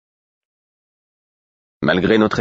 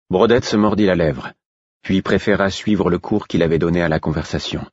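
ordinary delivery

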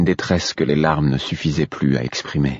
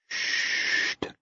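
soft unvoiced shh; whispered shush; 11 dB quieter than usual. A narrator's reading. Burrick hushing the crying baby, whispered. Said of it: "lui disait-il".

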